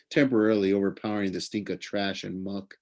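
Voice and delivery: usual speaking voice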